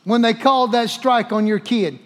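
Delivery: normal talking voice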